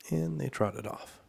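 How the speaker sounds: ordinary delivery, nothing marked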